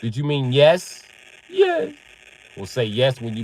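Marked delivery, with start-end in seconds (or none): 1.5-1.9 put-on tiny high-pitched child reply; imitated child, very high; little daughter voice. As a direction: answer high like a little kid.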